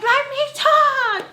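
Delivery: very high, loud, drawn-out mock yell; exasperated shout. Daughter cutting off her mom, exasperated mock shout. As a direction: shout over her, stretching it out.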